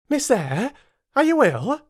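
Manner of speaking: high, concerned maid's voice; a kind servant startled by the child; Bessie the maid. Acted. Bessie the maid asks the frightened child, high and concerned. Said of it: "said Bessie".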